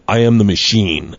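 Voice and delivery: low, slow announcement; deadpan declaration. Retold or imitated. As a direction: state it solemnly, dropping the pitch. Retold deadpan declaration in doorway.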